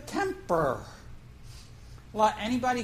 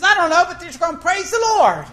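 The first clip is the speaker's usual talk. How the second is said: excited answer; 7 semitones higher, 8 dB louder than usual. Retold or imitated. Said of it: "She says".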